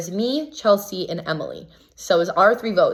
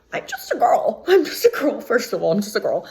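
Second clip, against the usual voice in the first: tiny, very high mock-innocent voice; mock helpless; 4 semitones higher than usual. Contestant pleading innocence to an angry housemate, mock helpless. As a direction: squeak it out like a helpless little thing.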